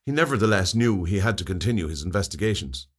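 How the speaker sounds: plain reading voice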